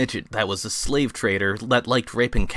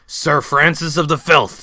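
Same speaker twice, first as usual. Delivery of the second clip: higher, louder gruff-toned character voice; Filthy Frank voice; 5 semitones higher, 5 dB louder than usual. Acted. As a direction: play Frank offering the baptism. Frank introduces himself.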